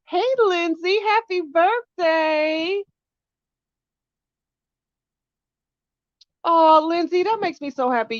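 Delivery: high, slow, happy birthday cry; high, loud thrilled greeting; delighted. Real, not acted. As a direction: gush with joy. Touched by birthday wish.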